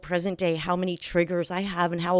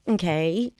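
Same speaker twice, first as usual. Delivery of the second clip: usual speaking voice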